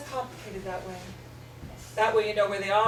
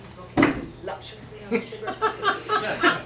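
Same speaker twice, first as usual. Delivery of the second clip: mock sultry; 10 semitones higher, 3 dB louder, slower than usual. Playing the seductress.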